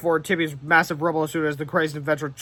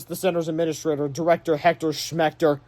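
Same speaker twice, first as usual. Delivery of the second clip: usual speaking voice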